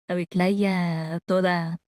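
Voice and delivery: normal talking voice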